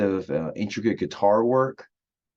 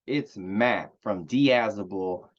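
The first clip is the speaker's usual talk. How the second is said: slow mock Texas accent, drawn out; slower than usual. Introducing himself on his review channel in mock Texas accent. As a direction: stretch "Diazable" like a put-on Texan. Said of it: "I'm doing a Texas accent".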